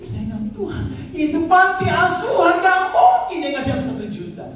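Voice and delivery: jeritan haru tinggi, dibesar-besarkan; tangis menjerit yang ditiru. Retold or imitated. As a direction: tirukan seruan menangis kepada Tuhan. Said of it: "saya nangis menjerit". (pura-pura menjerit menangis, nada melengking naik)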